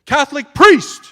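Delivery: very loud, high yell; full-voiced, high-pitched shout for help. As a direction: holler it down a corridor. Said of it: "I yelled out to the corridor".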